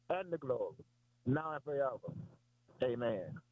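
low, measured recital of the Lord's Prayer. Acted. Preacher reciting the Lord's Prayer to the congregation, reverent and measured.

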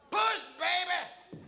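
high, drawn-out urging cry; hollered. Acted. People coaching a woman through childbirth, drawn-out holler. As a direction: holler it slowly, urging her on. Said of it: "and they holler".

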